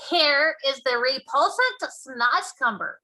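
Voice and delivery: loud, slow cry; cried out. Acted. Giant showing Sophie the vegetable, crying out. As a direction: cry it out in disgust. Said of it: "the B F G cried".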